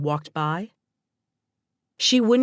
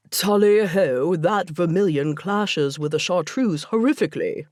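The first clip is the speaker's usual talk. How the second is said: bad English accent. Luke mocks the TV show to Brooke in bad English accent. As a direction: ham up a terrible English accent for a laugh. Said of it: "That is the worst English accent".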